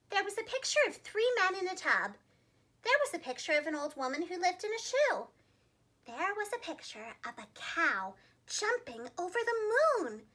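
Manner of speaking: ordinary delivery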